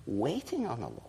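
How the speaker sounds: "Waiting on the Lord" whispered softly; reverent whisper. Shown as a demonstration. Preacher echoing the phrase to the congregation, whispered. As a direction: breathe it out in a hush.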